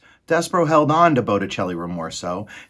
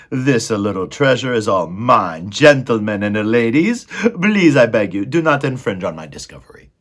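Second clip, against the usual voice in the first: loud, theatrical rat orator; calling out to rats; 2 semitones higher, 7 dB louder than usual. A narrator's reading. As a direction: grandly stake a claim before the pack. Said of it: "Botticelli called out to the other rats".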